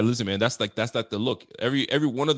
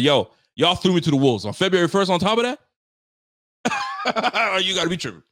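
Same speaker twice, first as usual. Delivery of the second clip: higher, punchy mock protest; retold indignant complaint; 6 semitones higher, 4 dB louder, faster than usual. Host voicing Kelce thrown to the wolves, mock indignant. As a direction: climb in pitch as the wronged man. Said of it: "He absolutely just crushed. He said".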